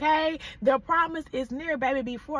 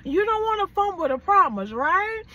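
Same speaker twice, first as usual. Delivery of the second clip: higher, louder preacher push; rising sermon fervor; fired-up preaching; 5 semitones higher, 3 dB louder than usual. Acted. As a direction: preach it hard to the crowd. Preacher builds to full force.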